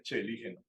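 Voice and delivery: "chelígena" opening with a ch sound; learned correct pronunciation. Shown as a demonstration. Teacher showing what the professor taught, correct pronunciation. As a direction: say "chelígena" as che-LI-hena. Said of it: "sé que se dice".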